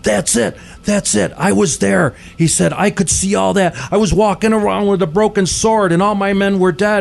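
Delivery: retelling a man tearing up at the memory; lightly voiced tearful recognition. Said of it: "suddenly he starts tearing up".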